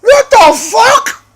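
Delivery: high, loud disbelieving shout; shocked yell. Retold or imitated. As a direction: yelp it out in outraged disbelief. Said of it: "I'm like".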